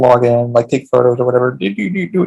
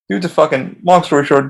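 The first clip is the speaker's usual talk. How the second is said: normal talking voice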